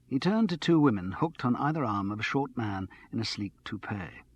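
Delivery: ordinary delivery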